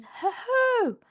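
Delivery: small animal puffing up to sound fierce; high, loud attempted growl. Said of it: "as fiercely as he could".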